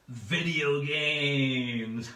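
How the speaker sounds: very slow, drawn-out round announcement; announcer drawl. Acted. Host announcing the video game round, drawn out slowly. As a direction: stretch it like a game show reveal.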